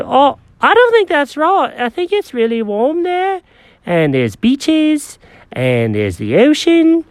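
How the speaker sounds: high, fussy cat voice; Jenkins the cat. Acted.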